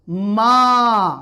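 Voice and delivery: मा with a long drawn ā; long ā demo. Shown as a demonstration. Teacher demonstrating the long vowel to students, drawn-out मा. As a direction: stretch the vowel in मा. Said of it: "word क्या बना?".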